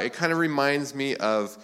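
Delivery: ordinary talk, nothing marked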